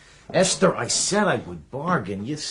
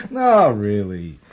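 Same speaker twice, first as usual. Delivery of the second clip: laughing; 9 dB louder, much slower than usual. Real, not acted. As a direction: still laughing through the words, slow. Laughing at old memories.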